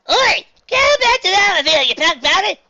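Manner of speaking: high, loud furious yell at a fielder; angry shout. Acted. Swiftbell yelling at a fielder, furious shout. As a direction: bellow it across the field. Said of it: "cried Swiftbell".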